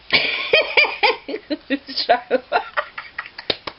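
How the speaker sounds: high, helpless real giggle. Real, not acted. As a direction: let the giggle fit take over. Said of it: "I'm gonna have giggles now".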